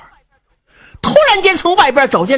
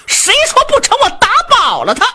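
much higher and louder, defiant; angry son's shout; 14 semitones higher, 4 dB louder than usual. Acted. Son glaring and shouting defiance at his father. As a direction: yell it back at the old man. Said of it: "冲他爹一瞪眼".

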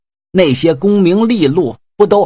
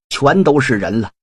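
usual speaking voice

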